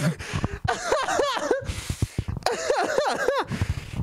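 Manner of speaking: long, high-pitched giggling fit; high laugh. Real, not acted. Streamer ending the bit, high laughing fit. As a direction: laugh in high, rapid bursts.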